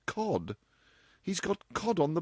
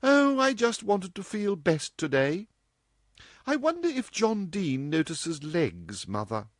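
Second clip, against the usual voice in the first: inconsequential; 11 semitones higher than usual. A narrator's reading. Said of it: "she added inconsequentially".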